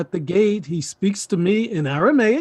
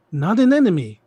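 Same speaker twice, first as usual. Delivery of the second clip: normal talking voice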